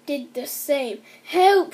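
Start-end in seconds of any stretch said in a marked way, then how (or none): 1.2-1.7 higher, louder mock alarm; wife crying out as she rises; cry for help. A narrator's reading. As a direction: call out in mock fright. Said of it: "she cried".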